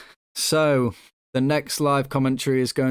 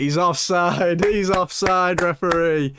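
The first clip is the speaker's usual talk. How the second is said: relieved laughter; 5 semitones higher, slower than usual. Real, not acted. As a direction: laugh out the offside call in relief. Opponent goal ruled offside.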